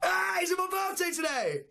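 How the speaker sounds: thrilled cry on hearing birthday wishes; excited shout. Real, not acted. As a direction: burst out in real, high-pitched excitement. Told happy birthday, excited reaction.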